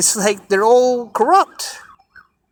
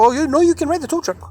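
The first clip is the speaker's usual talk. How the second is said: mocking quote; 8 semitones higher, faster than usual. Tow truck staff quoted.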